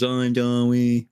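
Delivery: goofy impression of a sorry comedian; Louis C K. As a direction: mumble a mock-sheepish apology. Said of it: "he pulls a Louis C K and says".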